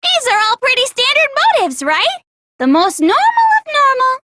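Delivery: high, quick, sing-song teasing; cutesy mockery. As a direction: tick the motives off like a giddy schoolgirl. Cutesy mocking summary.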